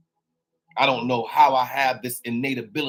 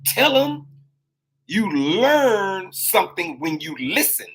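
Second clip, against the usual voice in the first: high, booming, slow proclamation of a higher voice; voice from above; 5 semitones higher, 4 dB louder, slower than usual. Retold or imitated. Speaker voicing the universe's message to viewers, slow booming proclamation. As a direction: intone it like a divine message. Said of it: "He said".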